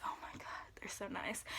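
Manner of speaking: soft, whispered swoon; awed whisper. Real, not acted. Vlogger gushing over her dream Benzes, awed whisper. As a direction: drop into a reverent whisper.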